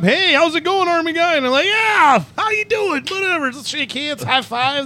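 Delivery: fake friendly greetings. Greeting strangers at the street dance, high and overly chummy. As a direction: bluff like you know everyone. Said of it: "you gotta act like it".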